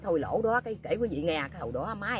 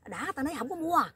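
much higher-pitched retold rebuff; exasperated refusal; 11 semitones higher than usual. Older man refusing the young jacket seller, exasperated. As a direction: mimic a fed-up customer, voice pitched up.

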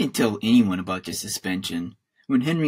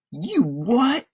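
stunned; 14 semitones higher, 4 dB louder than usual. Acted. Stunned boy hears news.

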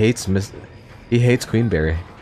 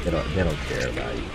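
soft, hushed mock sympathy; mock pity; 3 semitones lower, 7 dB quieter than usual. Real, not acted. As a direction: tease him in a near whisper. Whispered mock pity at killer.

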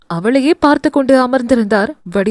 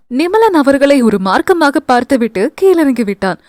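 usual speaking voice

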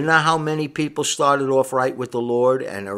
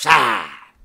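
low, loud scoffing "ah"; dismissive scoff; 3 semitones lower, 5 dB louder than usual. Retold or imitated. Preacher mimics a sinner brushing off reproof, dismissive scoff. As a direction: brush it off with a scornful noise. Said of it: "you brushed it off with".

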